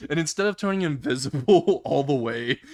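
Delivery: ordinary delivery, nothing marked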